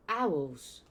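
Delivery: loud angry shout of Owls; bellowing Owls. A narrator's reading. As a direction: Vernon roars at the owl. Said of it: "bellowed Uncle Vernon".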